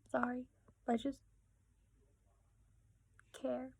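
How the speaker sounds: small, high, soft voice; sheepish apology. Retold or imitated. Acting out herself teased by sister, sheepish high, soft reply. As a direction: shrink into a quiet high-pitched apology.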